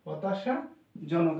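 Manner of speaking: dwelling on the meaning for the student; slow emphasis. Shown as a demonstration. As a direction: draw the word out for emphasis. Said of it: "teacher gives the meaning".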